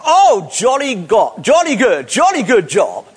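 hammed-up British cheer; British accent. Shown as a demonstration. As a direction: show off a jolly British accent, drawn out. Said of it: "speak with a British accent".

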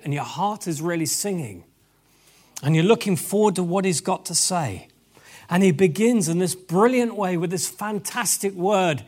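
usual speaking voice

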